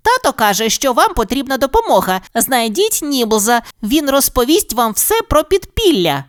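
very high squeaky shrew voice; tiny squeak. A narrator's reading. Fru-Fru calls them to the exit, squeaky. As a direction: squeak it out small and quick.

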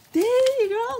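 high, loud and delighted; high-pitched praise for a puppy; excited cheer to a pet. Real, not acted. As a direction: cheer the dog on. Cheering dog opening its box.

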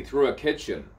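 ordinary talk

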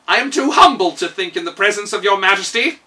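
high, loud humble steward; bowing and scraping to the king; Kaliko. A narrator's reading. Steward flattering the king, high and humble.

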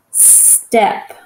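"Step" with a drawn-out s; sounded-out word. Shown as a demonstration. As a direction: hold a long hissed s in "Step", then land on the rest. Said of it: "just listen to the sound".

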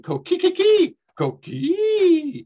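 high, loud chirping frog call; coqui frog call. Shown as a demonstration. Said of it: "you find them going".

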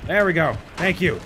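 excited relief. Real, not acted. Relieved cheering.

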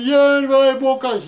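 hammed-up child's bawling plea; tearful begging. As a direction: wail loudly for what you want. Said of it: "plângând să ne iei niște bocanși".

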